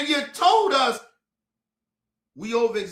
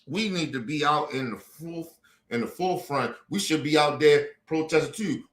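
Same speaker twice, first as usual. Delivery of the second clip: mock pretend plea; 7 semitones lower than usual. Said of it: "you wanna sit here and pretend".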